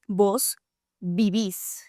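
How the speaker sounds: vos vivís, stress on the final syllable -ís, slow and clear; stress on last syllable. Shown as a demonstration. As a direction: slowly lean on the end: vivís. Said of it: "The accent on the last syllable changes everything".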